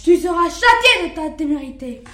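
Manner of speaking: loud furious snarl at the lamb; the wolf roars at the lamb; enraged wolf. Acted. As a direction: bellow it like a raging wolf.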